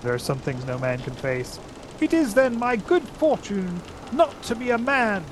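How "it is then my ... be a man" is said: high, proud mouse voice; stiff Reepicheep. Acted. Reepicheep answers the king with a stiff bow, high and proud. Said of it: "with a very stiff bow".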